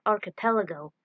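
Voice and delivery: usual speaking voice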